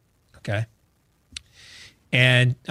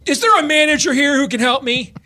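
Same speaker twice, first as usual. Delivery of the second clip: demanding customer; 10 semitones higher, 4 dB louder than usual. Retold or imitated. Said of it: "the guy's like".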